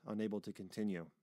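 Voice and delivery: usual speaking voice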